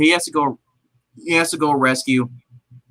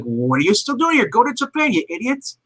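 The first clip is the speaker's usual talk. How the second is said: scolding boss; 9 semitones higher than usual. Retold or imitated. Rich guy scolding henchmen.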